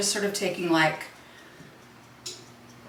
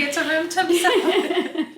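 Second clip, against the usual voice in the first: laughing; 9 semitones higher, 3 dB louder, slower than usual. Real, not acted. Jokes about cold dinner.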